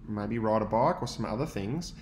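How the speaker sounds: plain reading voice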